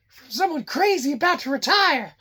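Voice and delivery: joking put-on voice. Co-host joke voice.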